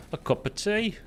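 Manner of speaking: a light British accent on cup of tea; mock British accent. Shown as a demonstration. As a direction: say it like a cheery Brit. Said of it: "your accent's perfect".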